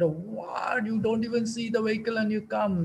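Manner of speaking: imitating a panicked lady driver's outburst; retold shout. Lady driver scolding him after the car crash, mock shout. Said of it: "she shouted".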